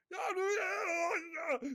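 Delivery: high panicked cry of no, no, no; panicked shouting. Retold or imitated. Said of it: "They're like".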